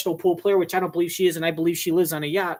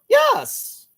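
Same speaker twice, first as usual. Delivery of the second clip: a yes jumping way up high and louder; squeaky high yes; 5 dB louder than usual. Retold or imitated. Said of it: "she goes high pitched".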